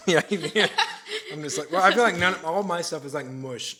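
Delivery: loud high giggly talk; laughing. Real, not acted. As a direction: laugh through the words. Laughing about chewing sounds.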